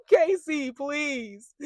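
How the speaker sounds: high-pitched, laughing plea; amused scoffing. Real, not acted. Viewer dismissing a contestant's worry, amused scoffing.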